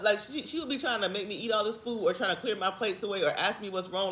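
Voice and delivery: ordinary talk, nothing marked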